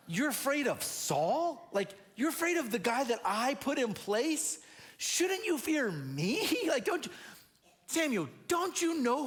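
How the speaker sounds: mock God amazed at the fear; incredulous god. God teasing fearful Samuel, high and incredulous.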